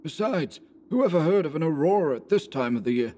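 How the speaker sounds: tense woodsman asking aloud; Anderson, uneasy. A narrator's reading. Anderson voiced in story.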